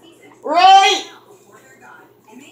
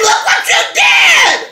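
much higher, slow, shouted look what you did; yelled rebuke; 11 semitones higher, slower than usual. Retold or imitated. Reliving the moment she yelled at the friend who hit the tires, a slow, high shout. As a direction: yell it out, drawn out and high. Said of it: "I said".

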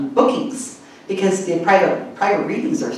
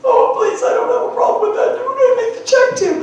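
high, fast mock eagerness with a laugh; eager client; faster than usual. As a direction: gush at the chance to pay, hammed up.

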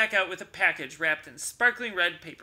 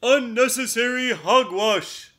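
loud, higher gruff bear scolding; 7 semitones higher, 11 dB louder than usual. Acted. Bear scolding Mouse about the present, loud. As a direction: scold him like a cross old bear. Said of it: "Bear scolded".

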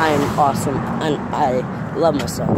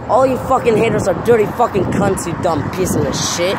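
furious yelling rant; 4 semitones higher than usual. Real, not acted. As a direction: shout them down in real anger. Ranting furiously at online haters.

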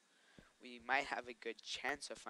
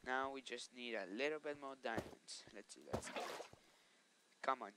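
normal talking voice

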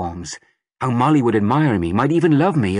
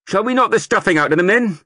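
loud, high recruiting sergeant rousing a crowd; sergeant major; 10 semitones higher, 4 dB louder than usual. A narrator's reading. Sergeant major rousing the market crowd, booming. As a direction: bellow it to the whole square like a drill sergeant.

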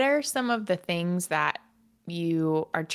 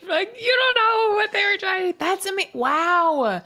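amazed; 11 semitones higher, 5 dB louder than usual. Real, not acted. Amazed reaction to story.

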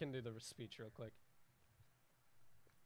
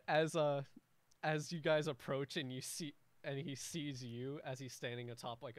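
normal talking voice

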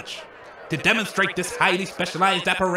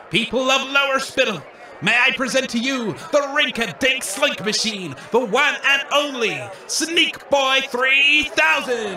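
showman announcement; 5 semitones higher than usual. Acted. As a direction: sell it to the crowd like a barker. Showman presents the new machine.

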